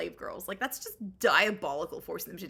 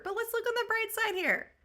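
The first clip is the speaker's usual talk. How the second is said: sarcastic cheer; 13 semitones higher than usual. Acted.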